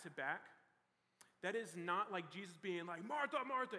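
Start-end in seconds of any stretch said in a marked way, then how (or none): 3.0-3.8 higher, louder angry call of the name "Martha, Martha"; angry scream. Retold or imitated. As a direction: bark "Martha, Martha" like an angry man. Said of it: "he's screaming like he's an angry person".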